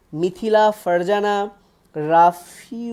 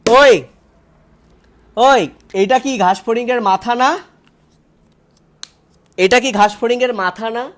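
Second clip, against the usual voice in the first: calling out; 3 semitones higher, 7 dB louder than usual. Real, not acted. Teacher calling out students' wrong answer, loud and teasing. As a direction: call out to the class, half teasing.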